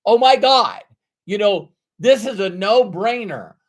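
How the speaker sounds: loud, high "Oh my God" bursting with excitement; eager beginner gushing loudly about a no-brainer; excited gush. Retold or imitated. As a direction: gush like a newbie who just found a sure thing. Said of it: "so excited with enthusiasm".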